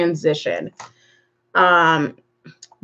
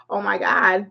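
ordinary talk

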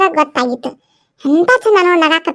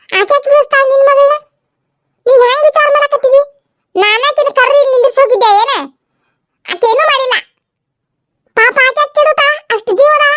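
scolding mother-in-law; 9 semitones higher than usual. Acted. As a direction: defend the girl and scold sharply.

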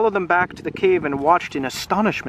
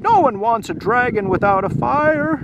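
higher, dismissive voice; the dragon repeats his family's rejection, higher; quoting family; 6 semitones higher than usual. Acted.

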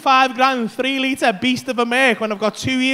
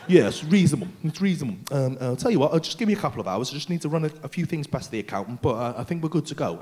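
lightly acted low, considered voice; mock businesslike deliberation; 11 semitones lower, 6 dB quieter than usual. Customer answering over car paperwork, mock businesslike deliberation. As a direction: pretend to weigh it up in a low register.

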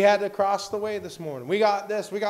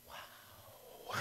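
whispered wow; 21 dB quieter than usual. Real, not acted. Stunned reaction to resident.

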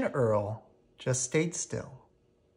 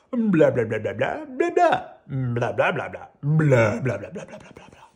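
TV babble; 3 dB louder than usual. Acted. Mimicking TV chatter.

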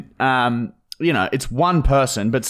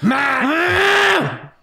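shouted announcement; 14 semitones higher than usual. Real, not acted. Announcing segment.

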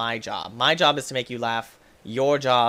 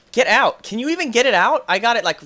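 1 exasperated yell; 9 semitones higher, 7 dB louder than usual. Real, not acted.

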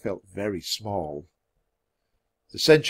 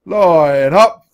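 very loud, raised drill command; centurion's bellow; 7 semitones higher, 11 dB louder than usual. A narrator's reading. Centurion lining up his warriors, bellowing. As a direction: bellow it across the armory. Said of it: "the centurion bellowed".

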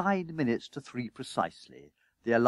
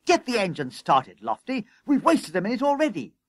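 urgent orders climbing high; harassed boss, pitch rising; frantic hurrying; 7 semitones higher than usual. A narrator's reading. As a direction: chivvy the driver along. Frantic boss hurrying his crew.

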